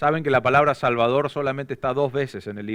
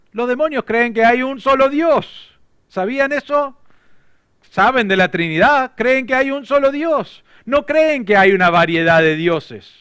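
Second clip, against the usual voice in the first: higher and louder, driving the point home; emphatic preaching; 8 semitones higher, 6 dB louder than usual. Acted. Preacher telling the church about demons, emphatic. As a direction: preach it with rising force.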